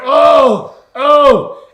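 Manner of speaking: loud, high groans of pain from far below. Retold or imitated. A man who fell down a ravine groans up to his friends, loud and high. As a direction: moan like you just fell down a ravine. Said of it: "I hear him down there".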